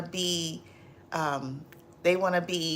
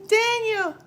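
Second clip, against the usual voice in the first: squealing name; 5 dB louder than usual. Real, not acted.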